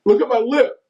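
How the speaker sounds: high-pitched plea to look; retold protest. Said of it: "like that".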